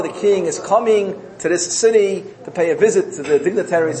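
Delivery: normal talking voice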